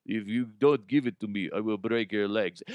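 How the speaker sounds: put-on low Russian-accented threat; Russian billionaire. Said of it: "this is rich Russian billionaire".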